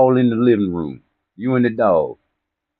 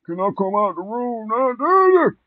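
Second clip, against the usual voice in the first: put-on high kid voice calling out, pleading; pleading child; 13 semitones higher, slower than usual. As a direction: beg from behind the door like a small kid. Child begging father from room.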